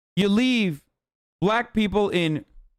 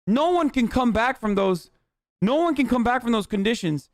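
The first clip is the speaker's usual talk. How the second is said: usual speaking voice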